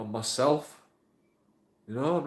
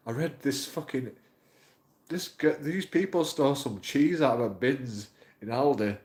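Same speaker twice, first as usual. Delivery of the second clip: ordinary talk, nothing marked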